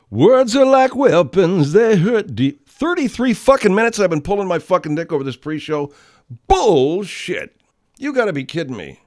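high, loud, angry outburst; loud frustrated ranting. Real, not acted. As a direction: blow up at the wait. Sings then rants loudly.

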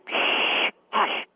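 long hissed shh, then a whispered hush; shushing. Acted. Caller quieting her laughing husband and brother-in-law, shushing. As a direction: hush them with no voice, just breath.

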